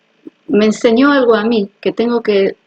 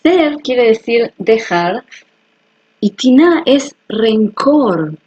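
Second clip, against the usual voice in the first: slow revelation; slower than usual. Shown as a demonstration. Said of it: "la revelación".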